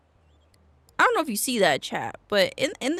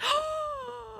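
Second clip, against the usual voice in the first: sharp high gasp; 6 dB quieter than usual. Real, not acted. Sudden surprise at a boost.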